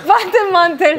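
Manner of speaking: laughing it out, high and loud. Real, not acted. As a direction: burst out laughing at herself. Laughs at own word mix-up.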